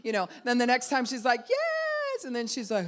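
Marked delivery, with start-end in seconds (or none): 1.5-2.2 much higher, loud ecstatic shout; thrilled squeal of release; joyful cry. Retold or imitated. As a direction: burst out with freedom. Princess celebrating freedom.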